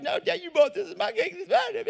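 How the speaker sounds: put-on high, whiny child insisting; kid voice. Pastor imitating his kids refusing him cake, high whiny child. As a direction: squeak it like a possessive little kid. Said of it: "And they'd be like".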